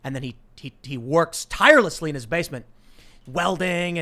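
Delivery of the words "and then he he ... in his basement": usual speaking voice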